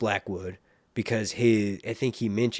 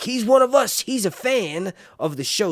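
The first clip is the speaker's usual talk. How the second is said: high, louder gushing cheer; excited fans; 10 semitones higher, 5 dB louder than usual. Pretend. Imagined viewers cheering their YouTuber as host, excited. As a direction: imitate thrilled viewers, pitched well up. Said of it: "y'all would be like".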